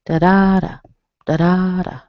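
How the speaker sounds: "da-da-da" as duh-DAH-duh; long strong middle syllable in "da-da-da"; stress in the middle. Shown as a demonstration. Teacher chanting the pattern, middle-stressed "da-da-da". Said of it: "only hear the vowel in the middle".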